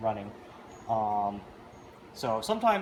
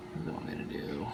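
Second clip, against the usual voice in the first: whisper; 12 semitones lower, 12 dB quieter, faster than usual. Real, not acted. Muttering to the stuck machine.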